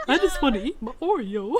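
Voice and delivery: imitating the line. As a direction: parrot it back like Scar. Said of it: "the way you delivered that line".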